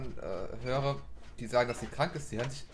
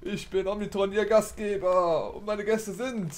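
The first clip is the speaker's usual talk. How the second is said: upbeat host welcome, raised pitch; announcer intro; 6 semitones higher, 6 dB louder, slower than usual. Acted. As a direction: greet viewers like a show host. Host intro of let's play. (begeisterte Moderatorenstimme)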